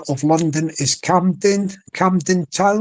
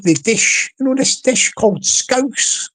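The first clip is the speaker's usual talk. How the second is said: normal talking voice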